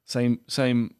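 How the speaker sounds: ordinary delivery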